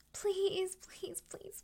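ordinary talk

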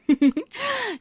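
high, loud gloating giggle; gloating laugh. Acted. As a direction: laugh in triumph at him.

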